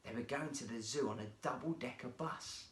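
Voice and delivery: plain reading voice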